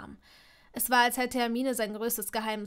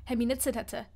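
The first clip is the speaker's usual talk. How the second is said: ordinary delivery, nothing marked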